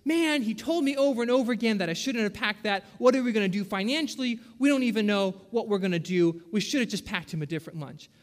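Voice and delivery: ordinary talk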